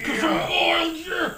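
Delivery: high, loud wrestler shout; mock wrestling war cry; old WWF wrestler. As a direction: belt it like a hyped-up wrestler. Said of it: "old W W F shit".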